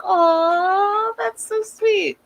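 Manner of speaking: high, slow, cooing "Aw, that's so sweet"; gushing aw. Retold or imitated. Recapping a show, gushing over dad and Kenny, high slow coo. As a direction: melt over a cute moment. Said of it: "And I was like".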